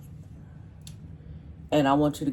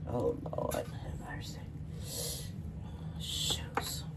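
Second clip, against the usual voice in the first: whispering; 4 semitones lower, 11 dB quieter, slower than usual. Real, not acted. Muttered exclamations while cutting.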